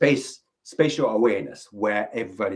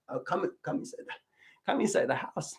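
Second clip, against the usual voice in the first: soft coaxing; 6 semitones higher, 9 dB quieter, faster than usual. Shown as a demonstration. Coaxing shy visitor.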